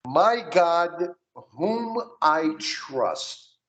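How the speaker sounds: "My God, whom I trust" slowly, with American vowels; model pronunciation. Shown as a demonstration. Teacher models the prayer line, slow "My God, whom I trust". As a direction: draw out "whom I trust" for a learner to echo. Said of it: "So repeat with me".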